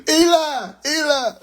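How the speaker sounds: very high, drawn-out wail; high-pitched comic anguished call; mock cry out. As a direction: cry out dramatically, hammed up. Mock crying out during whooping.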